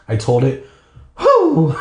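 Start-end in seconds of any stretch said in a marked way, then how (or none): 1.2-1.7 high-pitched whoop of relief; exaggerated exhale of relief; relieved whew. A narrator's reading. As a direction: let out a big high whew. Acted relief after rescue.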